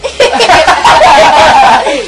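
laughing. Real, not acted. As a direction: laugh the words out. Laughing at the joke.

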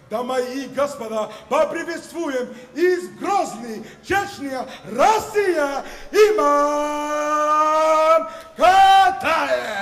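booming Russian fighter introduction; ring announcer. Acted. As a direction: build to the name, stretch it.

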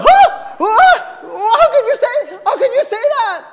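high, loud, shocked protest; outraged disbelief. Retold or imitated. Patient answering the nonchalant doctor, loud outraged disbelief. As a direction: shout back at the doctor in disbelief.

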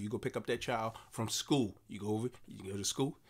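ordinary delivery

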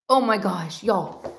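usual speaking voice

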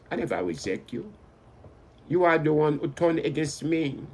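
usual speaking voice

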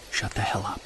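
harsh whisper telling him to shut up; urgent whisper. Acted. Hunter whispering to his friend about Sasquatch nearby. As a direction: keep it under your breath, urgent.

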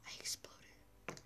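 whispered in disbelief; awed whisper. Acted. Godzilla whispering to himself that he exploded, awed. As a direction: breathe it out in awe.

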